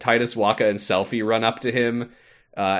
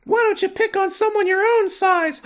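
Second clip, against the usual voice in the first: very high, squeaky puppet voice; a tiny wooden boy stepping in; squeaky high mock Pinocchio; 4 dB louder than usual. Voicing Pinocchio parody.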